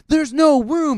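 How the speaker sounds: high, insistent mock girl voice; girl complaining. Girl fighting Kyle for the bed, high and insistent. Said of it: "all you hear her say is".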